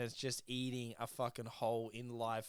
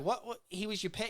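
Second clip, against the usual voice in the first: incredulous; 8 semitones higher, faster than usual. Retold or imitated. Gary's incredulous follow-up retold.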